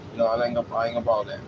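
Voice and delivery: ordinary talk, nothing marked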